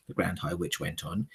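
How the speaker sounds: usual speaking voice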